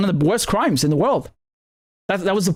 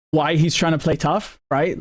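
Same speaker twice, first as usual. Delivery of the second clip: ordinary talk, nothing marked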